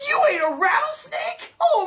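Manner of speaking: very high, disbelieving squeak; aghast at the rattlesnake news; shocked. Acted. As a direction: shriek the question in disbelief.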